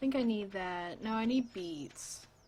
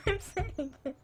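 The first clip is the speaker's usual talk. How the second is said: high, squeaky words through giggles; real helpless laughing speech; laughing protest; 8 semitones higher than usual. Real, not acted. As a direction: try to insist while cracking up.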